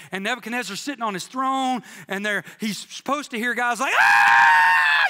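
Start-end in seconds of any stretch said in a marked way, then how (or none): 4.0-5.0 short loud mock scream of men in the fire. Preacher voicing gladiator victims, a loud scream. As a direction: let out a sudden pained yell. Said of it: "you know, screaming".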